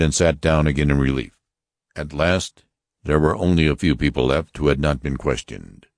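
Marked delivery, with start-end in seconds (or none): none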